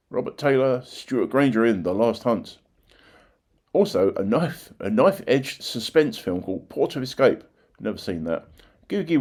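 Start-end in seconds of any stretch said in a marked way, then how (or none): none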